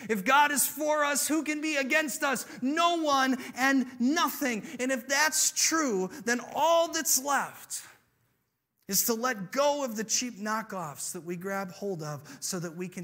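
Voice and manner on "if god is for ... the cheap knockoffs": loud, high, emphatic sermon climax; impassioned preaching. Real, not acted. Preacher rallies congregation on God's love, impassioned. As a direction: proclaim it, stretching the key words with full force.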